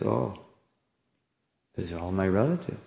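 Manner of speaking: soft, low, mildly dismayed aside; quiet dismay. Retold or imitated. Warrior seeing family in the enemy army, quietly dismayed. Arjuna sees his relatives opposing him.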